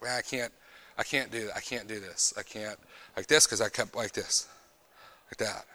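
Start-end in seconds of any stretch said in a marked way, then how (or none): 0.0-3.5 muttered panic. Retold or imitated. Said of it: "and I'm like".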